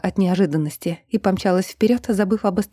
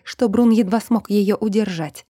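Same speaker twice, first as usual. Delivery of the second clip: usual speaking voice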